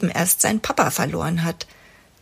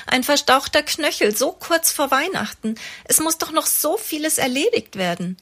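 klagend; 8 semitones higher than usual. A narrator's reading. Mama klagt weiter.

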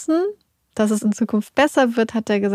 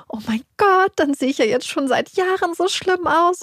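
retold wailing; 7 semitones higher than usual. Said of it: "Ich habe angefangen zu heulen".

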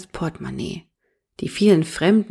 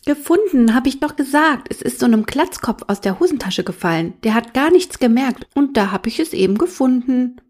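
put-on high, loud, sulky child protest; defiant little boy; 6 semitones higher, 3 dB louder than usual. A narrator's reading. Little brother explaining the found wallet, defiant. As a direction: protest innocence like a stubborn small boy.

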